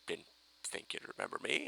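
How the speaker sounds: unvoiced hushed line; a mock villain's whisper. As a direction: breathe it like a secret. Retold villain line, breathy.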